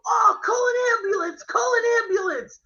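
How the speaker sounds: put-on high, crying old man calling for help; mock old man's cry. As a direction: wail for an ambulance like a frail old man. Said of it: "the old guy and he goes".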